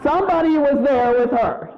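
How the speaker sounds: ordinary delivery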